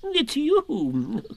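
high, fussy owl protest. A narrator's reading. Owl correcting Eeyore, high and fussy. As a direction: flap about insisting, owl-like. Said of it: "getting quite fussy".

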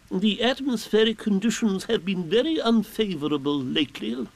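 high-pitched, pompous owl. A narrator's reading. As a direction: pronounce it grandly in a high owl voice. Owl makes pompous weather report.

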